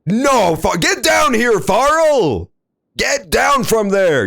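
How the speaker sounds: loud, high, mock-scolding call. Player scolds character Farrell to get down, loud and high. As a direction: call him down like a worried parent.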